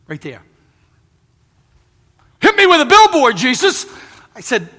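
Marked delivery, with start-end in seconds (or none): 2.4-3.9 high loud burst of amazement; loud exclamation. Retold or imitated. Man in restaurant exclaiming loudly to the preacher. Tattooed man's exclamation retold.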